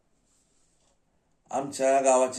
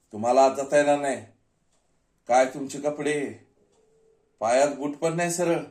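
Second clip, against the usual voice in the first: loud, higher, scolding guard; security guard refusal; 5 semitones higher, 9 dB louder than usual. Acted. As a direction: turn someone away with open disdain. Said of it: "सिक्युरिटीनं अडवलं".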